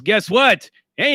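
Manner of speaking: normal talking voice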